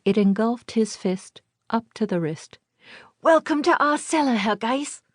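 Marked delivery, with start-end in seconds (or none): 3.2-5.0 put-on high, formal little boy; excited child. A narrator's reading. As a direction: welcome him like a proud kid. Child welcomes neighbor formally.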